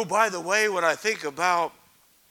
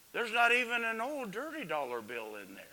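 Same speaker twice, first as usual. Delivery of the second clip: usual speaking voice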